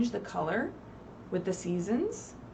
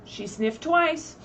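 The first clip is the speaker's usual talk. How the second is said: slow, raised read-aloud voice; storybook narration; 6 semitones higher, 5 dB louder, slower than usual. A narrator's reading. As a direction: draw out the sniffing for children. Teacher reading picture book aloud.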